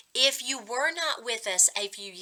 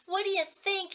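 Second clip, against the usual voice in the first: much higher, pitched-up yelling retort; angry shout; 11 semitones higher than usual. Acted. Brother yelling at his sister about the ice, shouting. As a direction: snap it back as a shout in pain. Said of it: "he shouted".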